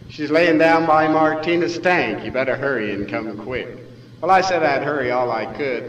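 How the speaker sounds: plain reading voice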